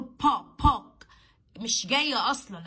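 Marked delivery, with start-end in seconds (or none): 0.0-1.1 "با، بوك" with a loud, popped p; wrong heavy p. Shown as a demonstration. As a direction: burst the lips into p on "با" and "بوك". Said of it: "ما ينفعش أقول با". ("با، بوك" بنطق p تقيلة غلط)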